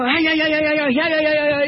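mock pine squirrel scolding; squirrel chatter. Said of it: "he's carrying on like that".

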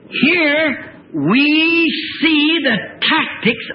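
very slow, high, stretched-out words; emphatic preaching. Real, not acted. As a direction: stretch each word for weight. Preacher announces his main point.

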